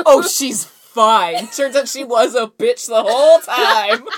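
loud slow sarcastic drawl. Real, not acted.

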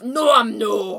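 loud Scottish No, I'm no; Scottish accent. Shown as a demonstration. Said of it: "in a Scottish accent, which is".

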